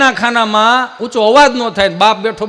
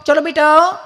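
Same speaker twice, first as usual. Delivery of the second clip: sugary modern mom; 10 semitones higher than usual. Acted. Modern mother sweetly coaxing Chintu to fill water. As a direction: coo sweetly at a pampered child.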